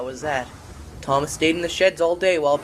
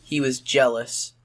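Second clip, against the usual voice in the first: plain reading voice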